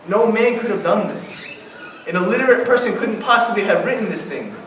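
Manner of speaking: normal talking voice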